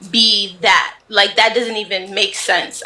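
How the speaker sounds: usual speaking voice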